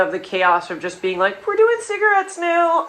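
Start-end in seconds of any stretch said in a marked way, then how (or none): 1.5-2.9 giddy announcement. Retold or imitated. Gleeful chaos quote.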